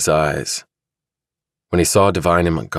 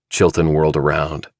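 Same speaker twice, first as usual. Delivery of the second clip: usual speaking voice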